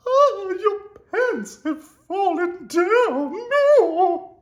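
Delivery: very high, loud, slow shriek; shocked scream. A narrator's reading. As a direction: ladies shriek at the sight. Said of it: "the ladies screamed".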